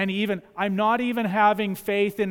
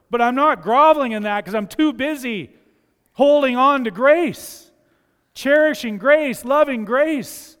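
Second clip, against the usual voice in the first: higher and louder, building into ecstatic praise; rising joyful praise; 6 semitones higher, 8 dB louder than usual. Acted. Voicing a believer's joyful gratitude.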